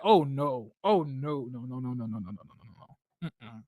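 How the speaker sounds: repeated no no fading to almost nothing; fading dread. Retold or imitated. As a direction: trail off into hopeless little noes.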